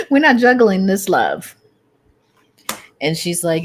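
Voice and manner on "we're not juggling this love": high, loud through laughter; giggling repeat of the joke; laughing. Real, not acted. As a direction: laugh the words out.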